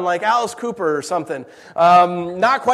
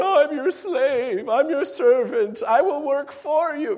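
higher, slow fawning; a lightly voiced fawning brother; mock servile brother; 8 semitones higher, slower than usual. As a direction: ham up the groveling. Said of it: "my brothers ever going".

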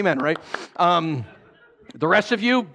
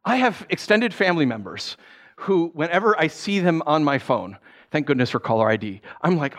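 ordinary talk, nothing marked

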